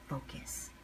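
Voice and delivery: breathy whisper of one word. Shown as a demonstration. Speaker to viewers, repeating focus as a whisper. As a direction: whisper it like a private command to yourself.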